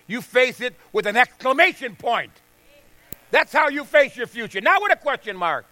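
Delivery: loud, high, driving preacher's cry; pulpit-pounding sermon peak; full-force preaching. Acted. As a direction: preach it hard to the congregation.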